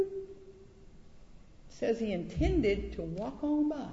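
drawn-out, very slow reading; slow emphasis. Real, not acted. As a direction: lean on each word slowly. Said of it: "emphasizes scripture phrase".

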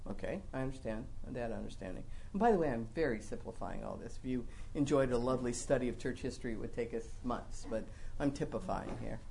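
low, soft parenthetical disclaimer; hushed, low-pitched side note; quick aside. Real, not acted. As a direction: drop right down and slip it in.

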